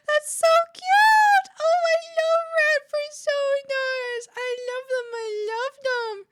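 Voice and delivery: very high, loud, squealing delight; squealing fan voice. Pretend. Streamer voicing a gushing fan reacting to her rat fursona. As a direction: shriek with gushing joy like an overexcited fan.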